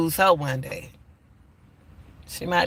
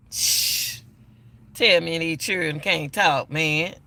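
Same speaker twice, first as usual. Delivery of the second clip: usual speaking voice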